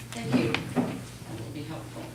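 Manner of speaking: usual speaking voice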